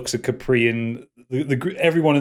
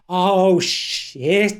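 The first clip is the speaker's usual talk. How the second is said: high, louder dawning alarm; dismayed; 7 semitones higher, 4 dB louder than usual. Acted. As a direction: let the dread hit you. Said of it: "kinda grip into, into his hairline".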